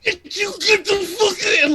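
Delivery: throaty, high-pitched road-rage yell; acted angry driver, all throat. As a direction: strain it out on neck muscle alone. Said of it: "the dude is talking and it's all throat".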